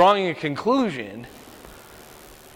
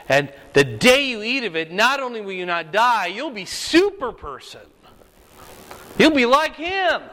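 rising serpent tempting; 6 semitones higher than usual. Retold or imitated. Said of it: "And he says".

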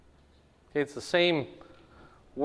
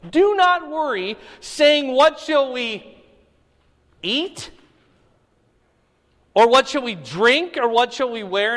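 higher, louder proclaiming voice; scripture reading; 7 semitones higher, 6 dB louder than usual. Acted. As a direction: declare the command to the room. Reads scripture aloud to class.

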